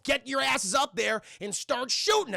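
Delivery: hammed-up boss giving orders; barked order. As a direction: drive it out slowly, like ordering henchmen to attack. Villain orders men to attack.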